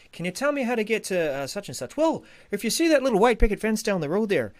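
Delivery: put-on high, loud, hammy Irish accent; bad Irish accent. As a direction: play a chatty Irish local giving directions, Irish accent. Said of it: "it's a terrible Irish accent".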